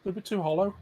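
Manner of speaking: talking through a bite of chicken; chewing between words; eating crunchy fried chicken. Real, not acted. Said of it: "I'm on about these breaded chicken bites".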